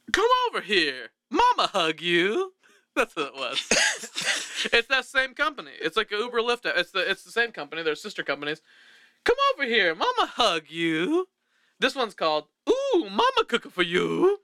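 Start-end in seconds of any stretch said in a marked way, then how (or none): 0.1-2.5 cartoon mama ad voice. Voicing the sister company ad. 9.2-11.3 cartoon mama ad voice. Repeating the ad tagline. 12.7-14.4 cartoon Italian mama. Naming the ad product.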